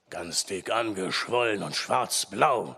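low, slow forester voice; deep, deliberate old forester. A narrator's reading. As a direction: draw it out low and grim. Forester describes the injured man.

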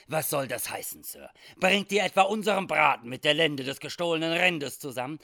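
loud, menacing challenge; rising anger, building to a loud, high pitch; threatening demand. Acted. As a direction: raise the voice and confront the accuser. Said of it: "fragte drohend".

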